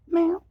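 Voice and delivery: very high, loud imitated meow; playful meow. Real, not acted. Owner meows at her cat to get an answer, high imitated meow.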